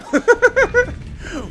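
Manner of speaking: high, loud burst of laughter; triumphant cackling laugh; gleeful laugh. Real, not acted. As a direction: laugh hard at the hit. Laughs after landing the shot.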